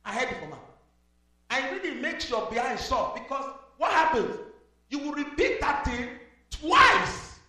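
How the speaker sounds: pastor's earnest sermon appeal; preaching. Acted. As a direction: plead with the flock from the pulpit. Pastor exhorting with music.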